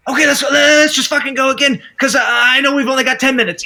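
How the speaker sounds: high, loud, fast urging; eager rush. Retold or imitated. Actor eagerly urging the crew to shoot again. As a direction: hurry everyone along, keen to go. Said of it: "he'll be like".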